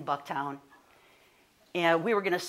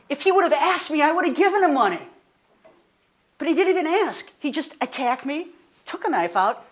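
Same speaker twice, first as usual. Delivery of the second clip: higher, rising indignation that builds louder; indignant outrage; 7 semitones higher, 5 dB louder than usual. Real, not acted. Telling a friend about the mugger, rising indignant anger. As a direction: let the outrage climb with each line. Recounting fury at her mugger.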